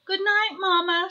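put-on high-pitched small child goodnight; sweet little high voice; Baby Owl voice. A narrator's reading. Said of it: "said Baby Owl".

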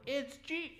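jumps high, breaking off at the name; startled recognition, cut short mid-word; stunned gasp. Retold or imitated. Disciple recognising Jesus at the table, stunned high cutoff.

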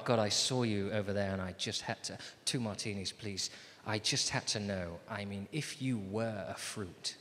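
low, soft confession; hushed earnest chat-up. Acted. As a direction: confess it softly to a stranger at the bar. Acts a sincere chat-up at bar.

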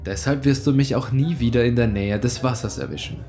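usual speaking voice

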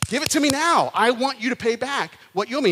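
hammed-up angry servant demanding payment. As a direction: bark it like a creditor choking him. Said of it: "he begins demanding".

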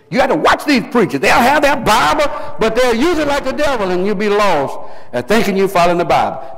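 high, urgent pulpit warning; full-force preaching. Real, not acted. Preacher warning listeners about false preachers, impassioned. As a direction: warn the flock with rising fervor.

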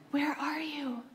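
soft whispered plea. Pretend. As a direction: breathe the question like a secret. God's question whispered.

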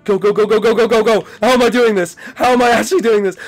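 high frantic shouts; excited shouting. Real, not acted. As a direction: shout in frenzied triumph. Fighting the animatronic, shouting.

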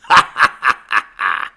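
loud, low staged "ha ha ha"; mocking laugh. Preacher acts out the Lord laughing at disaster, mocking laugh. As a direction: laugh in mockery, deliberately put on. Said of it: "the Lord's gonna laugh at you".

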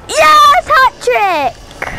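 high, loud victory yell; thrilled shout of triumph; excited cheer. Real, not acted. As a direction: celebrate the hat trick out loud. Scores a hat trick.

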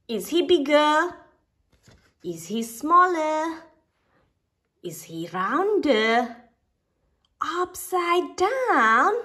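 a hint of chattering squirrels; curious squirrels. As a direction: guess eagerly in a small critter voice.

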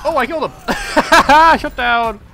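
loud, high shout breaking into laughter; real exultant outburst; gleeful triumph. Real, not acted. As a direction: crow over the kill with a big laugh. Celebrates a kill in game.

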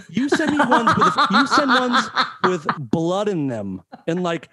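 laughing in disbelief; indignant, cracking up. Real, not acted.

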